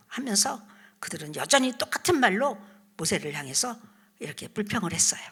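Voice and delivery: ordinary talk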